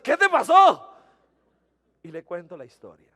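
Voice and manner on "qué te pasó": much higher and louder startled question; alarmed question. Retold or imitated. Wife seeing him with the wrecked bike, loud alarmed question. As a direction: burst out like you just saw him hurt. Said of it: "y me dice".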